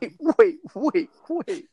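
high, incredulous wait wait; disbelieving wait. Real, not acted. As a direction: stop everything in high disbelief. Stopping the reading in disbelief.

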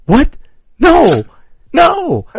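high, loud, wailed denial; retold horrified disbelief. Acts out his horrified realization.

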